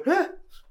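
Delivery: quick sharp panicked gasp; a sudden little gasp of panic. Retold or imitated. Friend imitating the actor's panicked gasp, sharp. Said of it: "just a wee panic".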